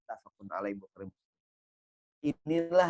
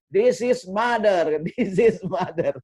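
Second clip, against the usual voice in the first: slow, loud, higher Indian English with a laugh; Indian shopkeeper; 5 semitones higher, 7 dB louder, slower than usual. Retold or imitated. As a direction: proudly present the chicken. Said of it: "Dia buka kulkas".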